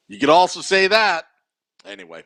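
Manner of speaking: ordinary talk, nothing marked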